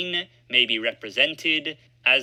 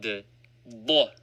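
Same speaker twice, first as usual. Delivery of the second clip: ordinary talk, nothing marked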